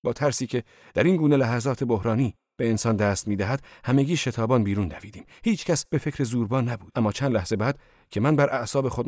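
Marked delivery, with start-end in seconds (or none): none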